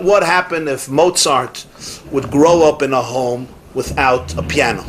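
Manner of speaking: ordinary talk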